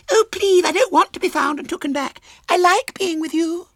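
put-on very high, pleading child voice; pleading little girl. A narrator's reading. Little girl begging to stay with the boys, pleading. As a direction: beg in a squeaky small girl's voice.

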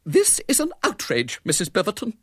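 very high, prim outrage; high-pitched affront; quivering indignation. A narrator's reading. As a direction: protest as a precise, offended spinster. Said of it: "quivered with indignation".

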